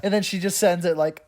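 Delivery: usual speaking voice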